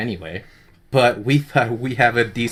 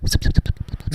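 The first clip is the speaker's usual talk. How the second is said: whispered zip sounds; 3 dB louder, much faster than usual. Pretend. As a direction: hiss out little zips. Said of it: "I'm whispering into your ear canal".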